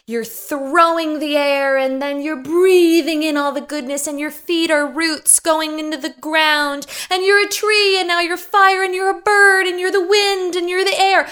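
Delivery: high, loud, swooping guru chant; mocking new-age guru. Comedian mocks Julianne Hough's stage chant, high and loud. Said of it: "she basically gets on the stage".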